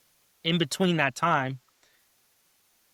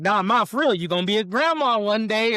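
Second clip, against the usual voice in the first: higher, louder playful insistence; excited retold insistence; insisting; 7 semitones higher, 5 dB louder than usual. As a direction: tease mom with the news. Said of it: "But I'm like".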